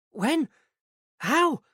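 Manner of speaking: high, halting gasped questions; barely able to get the words out; stunned, finding her voice. A narrator's reading. Manager told of a death, stunned high questions. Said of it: "she ventured when she finally found her voice".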